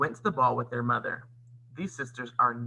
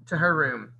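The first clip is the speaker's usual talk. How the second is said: ordinary delivery, nothing marked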